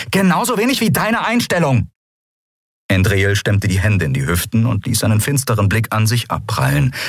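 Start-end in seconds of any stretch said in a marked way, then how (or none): 0.1-1.9 high, heated comeback; angry retort. A narrator's reading. Captain hitting back at Keru, angry retort. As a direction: fire it back in a raised pitch.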